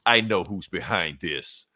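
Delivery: slow, low, grave reveal; ominous Superman. Retold or imitated. Superman naming the villain, slow low ominous reveal. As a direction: drop the voice dark and drag it out. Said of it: "Superman's just like".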